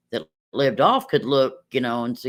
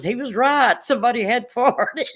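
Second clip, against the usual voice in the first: teacher laughing; 8 semitones higher, 3 dB louder than usual. Retold or imitated. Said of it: "she goes".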